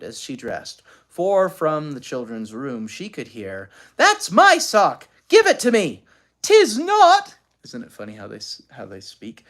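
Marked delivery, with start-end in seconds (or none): 4.0-7.4 put-on high, loud squabbling kid; quarreling children. A narrator's reading. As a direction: bicker over the sock.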